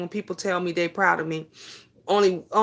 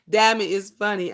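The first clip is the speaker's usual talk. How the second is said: usual speaking voice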